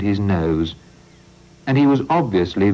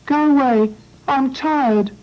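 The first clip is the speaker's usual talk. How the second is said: very high and louder, sleepy grumble; tiny voice; 4 dB louder than usual. Acted. Hedgehog telling the farmer to go away, very high and grumpy. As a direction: shoo them off like a cross little hedgehog. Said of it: "said the tiny voice".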